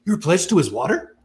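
high, eager mock excitement; perked up excitement. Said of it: "Yeah, he perks up".

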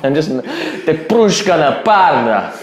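slow mock Russian accent with made-up words. Introducing himself as Dmitry in a mock Russian accent. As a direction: ham up a fake Russian accent. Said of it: "I'm just making up words".

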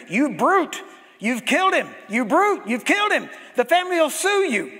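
high, loud accusing yell; retold scream. Doctor screaming after Wigglesworth, loud accusing yell. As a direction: scream it at him, chasing. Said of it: "starts screaming".

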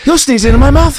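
high, loud, tough-guy Wahlberg voice; hammy Boston tough guy, pushed high; Mark Wahlberg impression. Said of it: "And Wahlberg's just about".

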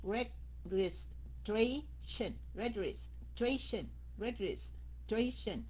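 "Registration" slowly as regis, pause, tration; divided into parts. Shown as a demonstration. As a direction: break "Registration" into clear chunks. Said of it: "Mình chia nó ra như vậy". ("Registration" chia ra, đọc thật chậm)

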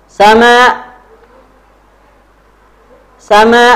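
high, loud model word, evenly repeated; drill call-out. Shown as a demonstration. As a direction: call it out and leave room to repeat.

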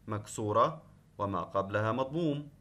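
ordinary talk, nothing marked

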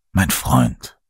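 low, tender address; gentle. A narrator's reading. Jesus greets his revived friend, gently. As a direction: say it gently, pitched well down. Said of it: "sagte Jesus sanft".

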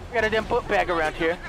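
ordinary talk

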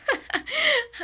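high, sheepish giggle; laugh. Real, not acted. Greeting viewers after a long absence, a sheepish high laugh.

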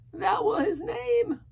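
high, slow, loud sailor voice; alarmed castaway. A narrator's reading. Castaway confirming the dreaded name, alarmed. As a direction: declare it high and drawn out.

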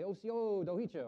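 "Osio dohichu" said slowly, pitched well up; slow, lifted Cherokee greeting; "Osio dohichu" spaced out and sung high. Shown as a demonstration. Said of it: "I would say".

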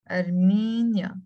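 "Armenia" drawn out at half speed; slow, measured model of Armenia; slow demonstration. Shown as a demonstration. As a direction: stretch Armenia out slowly for learners. Teacher models the whole word slowly.

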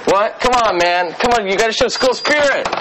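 usual speaking voice

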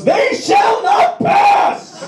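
hammed-up Gandalf bellow; Ian McKellen as Gandalf. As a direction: roar it like a wizard blocking a bridge. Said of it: "And Ian goes".

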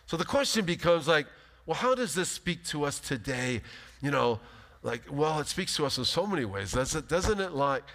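ordinary delivery

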